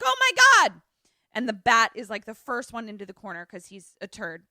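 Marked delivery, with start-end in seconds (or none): 0.0-0.8 high, loud, hammed-up panic; mock terrified scream. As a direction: shriek it like a cornered crowd. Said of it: "they're all in the corner screaming".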